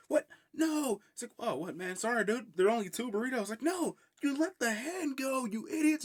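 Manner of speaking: high-pitched, hammed-up exasperation; mock outraged yelling. Anton finding the hand let go, high mock outrage. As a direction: scold them in a squeaky mock yell.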